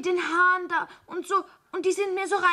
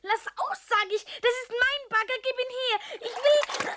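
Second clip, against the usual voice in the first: put-on shrill angry child; 6 semitones higher than usual. Child tugging, shrieking for digger.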